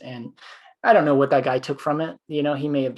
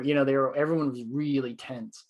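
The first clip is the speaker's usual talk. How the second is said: ordinary talk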